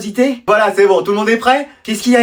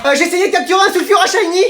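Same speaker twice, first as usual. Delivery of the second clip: high-pitched pleading pupil; desperate excuse; 10 semitones higher than usual. Acted. Pupil inventing excuses for lateness. (élève qui se justifie, voix aiguë)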